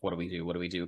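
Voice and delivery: low, hushed, helpless question; silent look for help. Acted. Player appeals to August, low and hushed. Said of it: "I look at you, uh, kind of in a".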